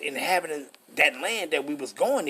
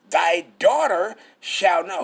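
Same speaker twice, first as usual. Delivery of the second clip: each word pressed out slow and louder; slow emphatic stress; 6 dB louder, slower than usual. Acted. Preacher pressing the verse on the congregation, slow and louder. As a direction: lean hard on every word. Preacher hammers scripture line.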